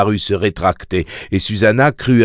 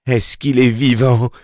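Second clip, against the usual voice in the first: raised-pitch, urgent cry; frantic yell; 5 semitones higher than usual. A narrator's reading. Mia asks Sayre about her baby, yelling. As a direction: cry it out, demanding to know. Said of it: "hurla Mia".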